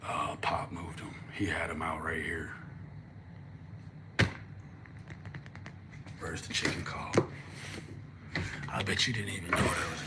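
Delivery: hushed whisper while searching. Real, not acted. Searching the room for the chicken call, whispering. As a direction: whisper it, so nobody hears you.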